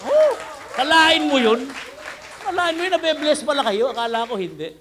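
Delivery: mock shout. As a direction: shout it at the crowd like a surprise. Preacher mock-shouting at congregation. (pabirong malakas na sigaw)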